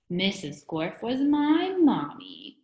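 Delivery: slow, loud donkey voice, swooping up high; sore-throated French donkey. A narrator's reading. Teacher reveals his mother to frightened pupils, slow donkey squawk. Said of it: "that was what Mister Gorf's voice sounded like".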